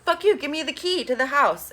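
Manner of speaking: retold angry cry. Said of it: "and she's like".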